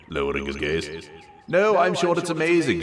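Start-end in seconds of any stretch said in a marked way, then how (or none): 1.5-2.8 high, louder insistence; emphatic assurance. A narrator's reading. Colette insisting to Anon the food is amazing, emphatic. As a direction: reassure him firmly, pitched up. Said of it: "she asserted".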